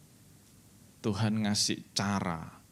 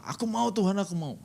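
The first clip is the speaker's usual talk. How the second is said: mock whiny prayer; 7 semitones higher, faster than usual. Mocking a selfish prayer.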